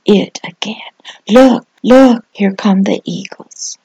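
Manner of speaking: hushed whisper. Acted. Pastor closing the message for the little ones, hushed whisper. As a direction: whisper as if pointing them out.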